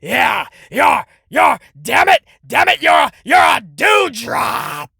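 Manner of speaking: mock Vince shout. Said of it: "and say".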